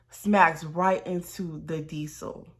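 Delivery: ordinary talk, nothing marked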